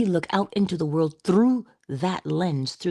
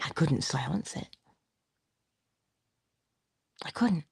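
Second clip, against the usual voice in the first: hushed, defeated whisper; soft, breathy admission; 6 dB quieter than usual. Real, not acted. Admitting she couldn't stop it.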